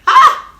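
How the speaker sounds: sharp high shriek of alarm; shocked scream. Retold or imitated. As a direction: let out a loud, high cry of shock. Can't reach the boyfriend by phone.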